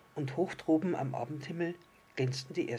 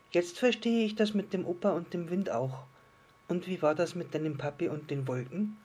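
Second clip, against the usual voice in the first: higher, lively voice of a little star; curious little star; 5 semitones higher, 3 dB louder than usual. Acted. Little star asks the island fish about Papi, higher and curious. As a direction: play a small, curious storybook star.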